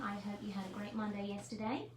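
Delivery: ordinary delivery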